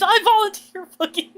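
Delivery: high, loud dramatic volunteering; Hunger Games volunteer shout. Pretend. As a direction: shout it like stepping up as tribute. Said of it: "Imagine doing the Hunger Games".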